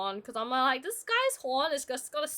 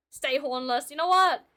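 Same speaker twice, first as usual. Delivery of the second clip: normal talking voice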